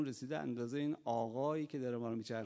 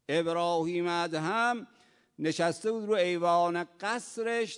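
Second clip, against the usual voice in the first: loud, high storytelling from the pulpit; preaching narration; 4 semitones higher, 7 dB louder than usual. Real, not acted. Preacher telling the congregation a story, loud and high. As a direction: drive the story forward loudly.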